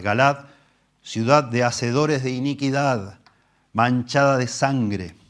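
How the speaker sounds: slow, weighty Bible reading; solemn scripture reading. Acted. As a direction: read the psalm with gravity. Reading the prophet aloud.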